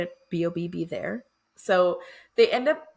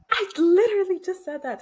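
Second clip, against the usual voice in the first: high, louder mock-exasperated retort; exasperated Liv; 11 semitones higher, 4 dB louder than usual. Liv retorting to Bennett about the hot dogs, mock exasperation. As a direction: toss it back, half-annoyed, half-amused. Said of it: "And she's like".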